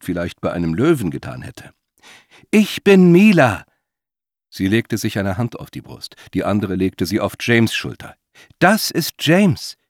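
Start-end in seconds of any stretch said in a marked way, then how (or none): 2.5-3.7 high, loud, slow words; emphatic introduction. A narrator's reading. Woman calming the old man, slow and loud. Introduces herself to calm him. 8.6-9.8 high, slow, louder words; emphatic introduction. A narrator's reading. Woman introducing her companion, slow and insistent.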